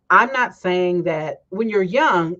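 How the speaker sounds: normal talking voice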